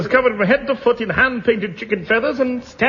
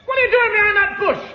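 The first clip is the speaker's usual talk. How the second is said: calling out; 9 semitones higher, faster than usual. Acted. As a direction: shout it high across the field at someone hiding. Calling out to hidden friend.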